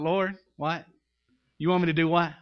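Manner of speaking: puzzled pause, then disbelief; incredulous Moses. Retold or imitated. Moses baffled by God's command.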